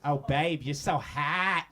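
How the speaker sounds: hammed-up Boston accent, slow and drawn; mock a Boston lover in a Boston accent; lightly put-on Boston accent, slow and leering. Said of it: "with that accent".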